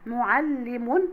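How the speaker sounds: slow معلم said "mu-ʿal-limun"; doubled l. Shown as a demonstration. Teacher showing shadda, معلم slowly with a held l. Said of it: "حرف اللام برضو".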